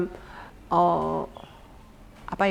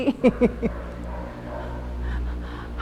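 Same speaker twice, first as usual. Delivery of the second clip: soft chuckle; 3 semitones higher, 6 dB quieter than usual. Real, not acted. Preacher jokes about a coffin-sized room, soft chuckle. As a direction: chuckle softly at your own joke. Laughs at own coffin comparison.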